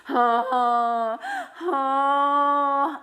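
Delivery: loud, high, wailing spirit moan; ghostly moan. Retold or imitated. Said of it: "I hear this moaning".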